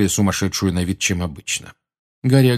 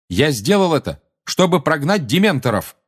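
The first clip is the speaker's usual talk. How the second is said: put-on loud, higher-pitched boy's declaration; 8 semitones higher, 3 dB louder than usual. A narrator's reading. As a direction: speak up firmly so the whole room hears. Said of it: "громко сказал Гарри".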